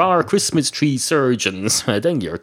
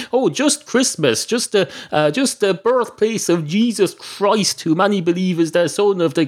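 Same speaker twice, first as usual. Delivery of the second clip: indignant; 8 semitones higher than usual. Acted. Mock-incredulous at "just Christmas.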